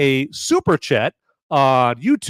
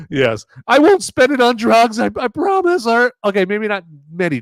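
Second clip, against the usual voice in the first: mock earnest beggar; 8 semitones higher, 5 dB louder than usual. Continues beggar bit, promising.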